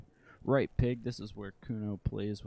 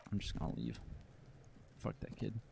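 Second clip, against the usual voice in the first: muttered exit; 9 dB quieter than usual. Acted. Muttered aside before leaving.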